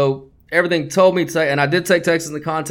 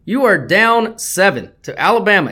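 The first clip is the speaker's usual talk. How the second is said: ordinary talk, nothing marked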